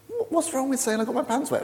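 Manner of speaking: high-pitched mock bafflement; baffled American. Americans asking the British what is wrong, baffled.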